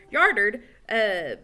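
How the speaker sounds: plain reading voice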